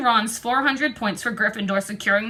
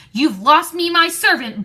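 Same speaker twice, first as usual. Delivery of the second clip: angry snarl; 6 semitones higher, 5 dB louder than usual. A narrator's reading. Lucius lunges at Harry.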